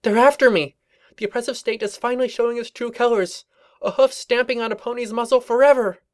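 high, panicked pony voice; frantic young mare voice. Acted. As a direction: gabble it out in paranoid panic. Twilight's panicked paranoid voice.

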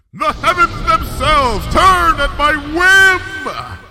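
triumphant roar, high, loud and drawn out; exultant booming shout. A narrator's reading. Luna throws her head back at the height of her power, exultant shout. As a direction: proclaim it to the skies in exultation. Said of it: "exulted Luna".

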